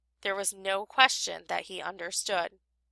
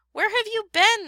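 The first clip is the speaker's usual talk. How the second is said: high, worried cry; 12 semitones higher, 4 dB louder than usual. A narrator's reading. Friends crying out to the late professor, high and worried. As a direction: cry out together in worry. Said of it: "they cried".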